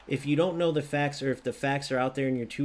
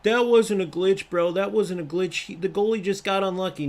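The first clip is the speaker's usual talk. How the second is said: higher, louder mock whiny voice; mocking McGrilly; 5 semitones higher, 5 dB louder than usual. Streamer mocking a viewer who denies the glitch, taunting. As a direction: sneer as the clueless viewer. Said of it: "this McGrilly guy would be dumb enough to go".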